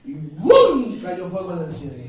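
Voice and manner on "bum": loud imitated blast with a swooping pitch; explosive boom. Preacher imitating fire crashing down from heaven, explosive boom. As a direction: burst out a sudden big boom.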